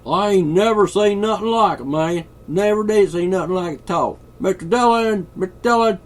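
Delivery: very high, loud hillbilly holler; hillbilly accent. Pretend. Host demonstrating other accents, loud mock hillbilly. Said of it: "You know, hillbilly".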